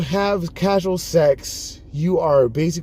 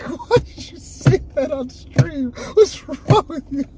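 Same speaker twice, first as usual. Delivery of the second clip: high laughing mock scolding; 10 semitones higher, slower than usual. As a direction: scold the friends while cracking up. Retold scolding of friends.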